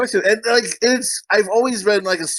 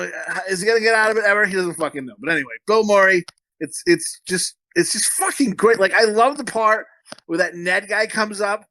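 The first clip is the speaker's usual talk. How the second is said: ordinary talk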